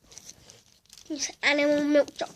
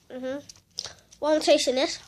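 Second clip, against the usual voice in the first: ordinary delivery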